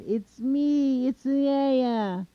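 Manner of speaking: high, loud holler announcing himself; long, high carrying call. A narrator's reading. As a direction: shout it down from a height. Coyote stands and calls down.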